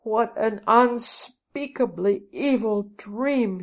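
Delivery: slow, high-pitched cry of horror; appalled, drawn-out exclamation; dismayed cry. A narrator's reading. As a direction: cry it out slowly, stretching the words. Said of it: "she cried".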